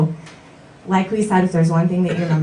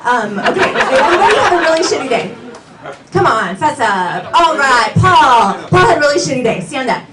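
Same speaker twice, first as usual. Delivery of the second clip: higher, louder jittery crackhead voice; tweaking; 7 semitones higher, 6 dB louder than usual. Pretend. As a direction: play a twitchy, wired crackhead. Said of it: "so I'm gonna speak like a crackhead".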